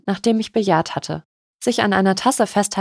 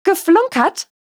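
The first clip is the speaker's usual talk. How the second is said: much higher, indignant GEFLUNKERT as a question; shrill, incredulous echo of "Geflunkert"; 11 semitones higher, 4 dB louder, slower than usual. A narrator's reading. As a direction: shriek "Geflunkert?" in outraged disbelief. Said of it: "Lady Angelas Stimme war schrill".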